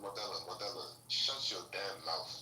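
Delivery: stern scolding. Real, not acted. Man scolding Modelo for embarrassing his nation, stern. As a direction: shut them down, weighing each word.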